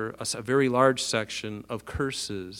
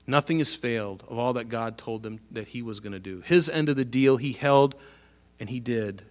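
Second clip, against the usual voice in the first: normal talking voice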